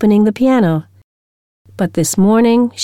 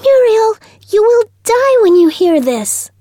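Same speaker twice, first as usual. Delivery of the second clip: excited cry. A narrator's reading. Schoolgirl calling to Muriel down the corridor, high excited cry. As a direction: call out high and thrilled like a bird.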